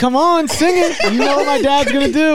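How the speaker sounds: pushy daughter voice. Retold or imitated. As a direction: nag him like a spoiled daughter. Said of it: "the daughter's like".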